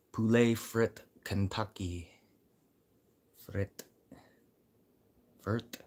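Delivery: "Poulet frit Kentucky" in a French accent, slowly; French pronunciation. Shown as a demonstration. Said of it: "Poulet fried chicken".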